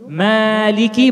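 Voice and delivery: MALIKI slowly, the "ma" light and fronted, drawn out; smiling thin m. Shown as a demonstration. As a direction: smile on the "ma" of Maliki. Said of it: "baca mim dengan senyum". (Maliki dibaca tipis dengan senyum)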